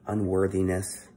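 usual speaking voice

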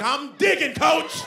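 straining player. Retold or imitated. Player answering his coach, high strained mock yell. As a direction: yell back you're trying hard. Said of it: "I'm like".

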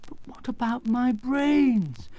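high, drawn-out demand; indignant protest. Acted. Companions challenging the wizard's broken promise, indignant.

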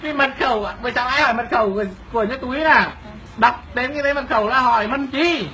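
ordinary delivery

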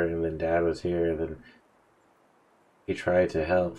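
ordinary talk, nothing marked

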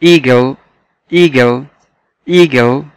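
إيجل said clearly for repetition; إيجل pronounced carefully; model pronunciation. Shown as a demonstration. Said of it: "مرة كمان".

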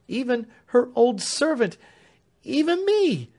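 high, pleading and urgent; agitated servant pleading with Warden; sheer agitation. A narrator's reading. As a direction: beg him, voice climbing. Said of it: "clapping her hands in sheer agitation".

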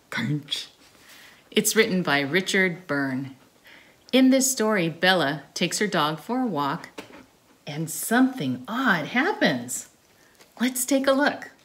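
put-on loud, bright presenter opening for children; storytime host intro. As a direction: welcome the little ones like a kids' show host. Opening the story time show.

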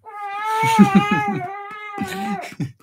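long, high-pitched laughing fit; high laughter. Real, not acted. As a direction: burst into a long squealy laugh. Laughing at fairy bread chat.

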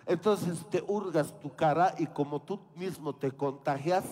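usual speaking voice

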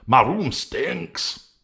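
slow, high, loud whiny voice; Integrity at the smelly room, slow and high; Integrity's complaint. Acted. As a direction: drag it out, high and disgusted. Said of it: "cried Integrity".

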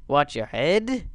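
ordinary delivery

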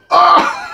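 very high shriek, then laugh; shriek, then break into laughter; scream into laughter. Real, not acted. Jump scare in game.

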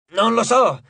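grumpy growl. A narrator's reading. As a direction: bite off the words. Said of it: "ringhiò Hedge".

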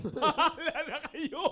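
slow, drawn-out reading with a laugh; trailing dots. Retold or imitated. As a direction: stretch it out as if full of ellipses. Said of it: "May mga dot, dot, dot". (mabagal at pabiting bigkas)

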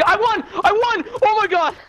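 high, loud victory shout; ecstatic yell. Real, not acted. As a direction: scream with real joy. Wins the Gulag fight.

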